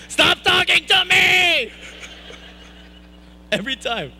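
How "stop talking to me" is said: high, slow, shouted whine; whiny shout. Retold or imitated. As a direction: yell it like a fed-up little kid. Kid at bat, hated it.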